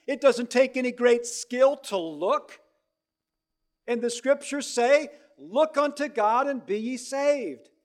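loud, slow country preacher; rustic preacher. Retold or imitated. Lay elder preaching to young Spurgeon, loud and slow.

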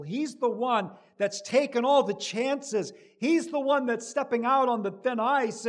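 building, loud impassioned preaching; preaching at full force. Acted. Preacher voicing Abraham's fears to the congregation, impassioned. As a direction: build the fear to a climax.

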